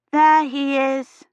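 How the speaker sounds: put-on high, slow, delighted little girl; a small girl lighting up at seeing him; excited child voice. Child spots the priest.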